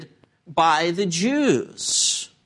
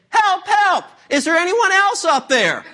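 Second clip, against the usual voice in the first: high, loud comic desperation; hammed-up panicked punchline shout; shouting for help; 10 semitones higher, 5 dB louder than usual. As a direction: yell upward for any other rescuer. Acting Jack shouting for someone else.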